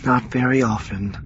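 a resigned, sighing agreement; agreeing with a sigh. A narrator's reading. Wife agreeing they get no break, with a sigh. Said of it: "she agreed with a sigh".

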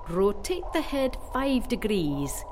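slow, deliberate direction; instructing. A narrator's reading. Doctor listening on the headset, slowly instructing. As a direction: draw it out while listening intently. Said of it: "he instructed".

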